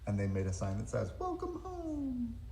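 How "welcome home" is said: sweet high voice. Acted. Sign read in voice.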